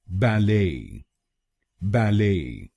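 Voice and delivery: बैले as ba-LAY, held second syllable; American बैले, silent T; American pronunciation. Shown as a demonstration. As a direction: lean into the end of बैले. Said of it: "तो बनेगा".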